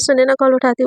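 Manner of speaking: usual speaking voice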